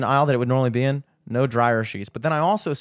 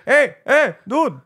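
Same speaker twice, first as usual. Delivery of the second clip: higher, louder mock call for attention; 7 semitones higher, 4 dB louder, slower than usual. Clerk to customer through the window, calling out. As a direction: call after someone through glass.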